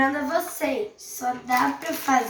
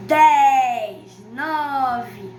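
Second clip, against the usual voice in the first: calling out the count, louder; loud countdown; 8 dB louder, much slower than usual. Real, not acted. Counting down the microwave timer.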